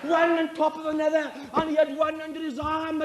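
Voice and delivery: normal talking voice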